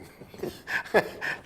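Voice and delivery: a quick breathy laugh. Real, not acted. Host chuckling at his own racetrack grudge, breathy laugh.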